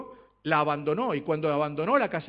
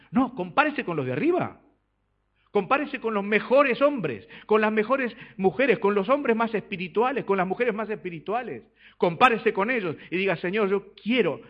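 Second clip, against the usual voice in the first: raised, higher preaching voice; ringing pulpit exhortation; emphatic exhortation; 6 semitones higher, 5 dB louder than usual. Real, not acted. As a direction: urge the congregation upward, loud and insistent. Preacher exhorting congregation to aim higher.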